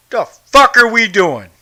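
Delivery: usual speaking voice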